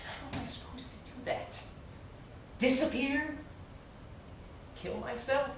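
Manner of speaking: soft, breathy, bitter questions; bitter hushed retort. Real, not acted. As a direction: spit it out quietly, wounded. Bitter outburst to Mister Green.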